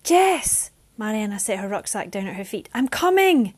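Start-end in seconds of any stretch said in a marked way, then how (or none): 0.0-0.7 high, loud cry of a name; urgent call to a struggling friend. Acted. Mariana calls her friend. 2.7-3.5 high, loud shout; yelled. Acted. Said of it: "she yelled".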